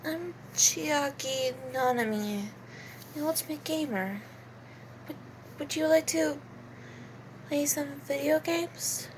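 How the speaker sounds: soft, quiet gamer with a smile; quiet, gently cheerful introduction; Chiaki Nanami. Acted. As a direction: speak softly and sweetly, hesitant. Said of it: "sorta quiet, but always have a s-, has a smile".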